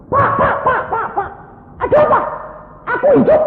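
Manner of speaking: acted high, loud, repeated cries; hammed-up astonished yelling; excited shouting. Bedside commotion as the patient revives, excited mock shouting.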